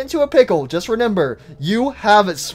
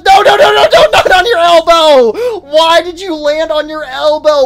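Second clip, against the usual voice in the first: real yelling, much higher and louder; dismayed shout; 8 semitones higher, 7 dB louder than usual. Real, not acted. Watching Huggy hit his elbow, shouting in dismay. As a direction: protest at the bad landing.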